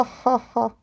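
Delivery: ordinary delivery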